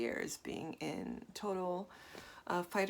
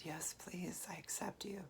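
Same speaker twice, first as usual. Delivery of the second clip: a hushed, softer yes please; soft acceptance; 8 dB quieter than usual. Retold or imitated. Welcoming her own buried emotions, hushed and soft. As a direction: let it in, near a whisper. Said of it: "I said".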